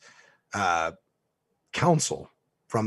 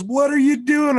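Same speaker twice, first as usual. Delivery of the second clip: high, loud cry of disbelief; incredulous shout; 11 dB louder than usual. Retold or imitated. James shouts at his client, incredulous yell. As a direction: shriek it in shock. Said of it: "And James goes".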